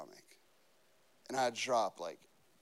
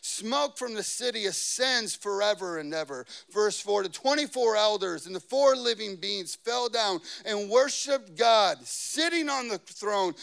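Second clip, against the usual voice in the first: raised, ringing preacher's reading; forceful scripture reading; 4 semitones higher, 6 dB louder than usual. Real, not acted. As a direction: proclaim the verse with emphasis.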